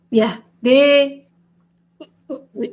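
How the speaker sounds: usual speaking voice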